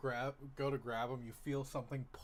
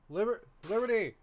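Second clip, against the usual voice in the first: calling loudly from afar; 10 semitones higher, 13 dB louder than usual. Acted. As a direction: call her name across the house. Parent calling her from another room.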